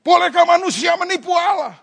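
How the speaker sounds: loud, high booming angry shout; angry God. Retold or imitated. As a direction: thunder it like an enraged God. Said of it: "Dia lagi ngamuk". (suara Tuhan yang sedang murka)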